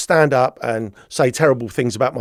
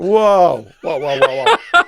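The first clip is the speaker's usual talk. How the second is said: loud, startled whoas, tumbling out; startled protest; 3 semitones higher, 3 dB louder, much slower than usual. Retold or imitated. Accused man to police sergeant, startled protest. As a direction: re-enact being taken aback by an accusation.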